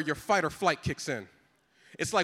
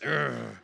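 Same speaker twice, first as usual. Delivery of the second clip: dog growl; 7 semitones lower than usual. Retold or imitated. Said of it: "like D M X".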